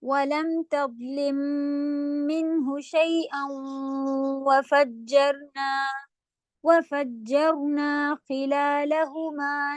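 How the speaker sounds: very slow, drawn-out Quranic recitation. Shown as a demonstration. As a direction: recite the verses slowly. Models the verse recitation. (بہت آہستہ تلاوت)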